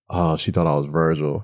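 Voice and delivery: low, soft retold realization; quiet realization. Retold moment of sudden realization.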